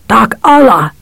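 gargling, guttural call. A narrator's reading. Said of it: "in his guttural, gargling voice".

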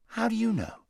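put-on high, protesting young boy; indignant boy voice. A narrator's reading. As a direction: push back, bewildered and high. Boy asks amazed.

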